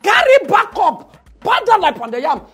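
high, loud indignant rant; mock outraged shout. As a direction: yell it like you were cheated.

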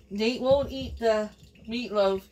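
usual speaking voice

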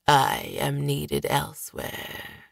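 husky voice. Acted. Master speaks through dead body.

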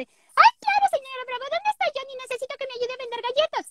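put-on very high, fast bratty little girl; Susie voice. Doing Susie from Johnny Bravo, very high and bratty. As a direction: squeal it like a pushy little girl selling cookies. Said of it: "a la Susi de Johnny Bravo".

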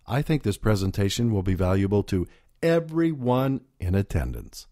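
"everyone" lifted and leaned on, "in attendance" dropping away; stress on everyone. Shown as a demonstration. Speaking coach showing audience how stressing "everyone" includes all. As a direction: hit "everyone" to include the whole room. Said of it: "The emphasis here implies that some might doubt the value".

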